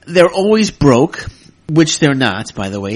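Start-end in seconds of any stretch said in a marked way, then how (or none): 0.1-1.3 louder, slower, sneering mock voice; mocking a commenter. Podcaster quoting a troll's comment, sneering mock voice. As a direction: parrot the troll with scorn. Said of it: "his response was".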